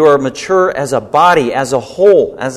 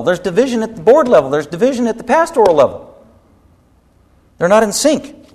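higher, slowed preaching with "division at the ... level" hammered; impassioned preaching; 8 semitones higher than usual. Acted. Pastor warns the congregation about church splits, higher impassioned preaching. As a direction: plead urgently about churches falling apart.

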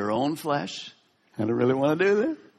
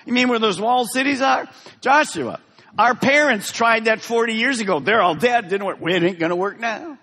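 scoffing Israelites; 7 semitones higher, 3 dB louder than usual. Acted. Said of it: "He says".

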